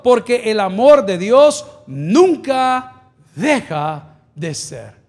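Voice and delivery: loud, slow, stretched key words; emphatic preaching. Real, not acted. Pastor explaining God's love to the church, emphatic preaching. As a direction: proclaim it with drawn-out emphasis.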